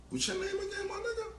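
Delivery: high, stunned question through a laugh; amazed. Retold or imitated. To the fifteen-year-old after his verse, amazed laughing question. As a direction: blown away, voice jumping way up.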